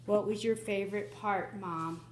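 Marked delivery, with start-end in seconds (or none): none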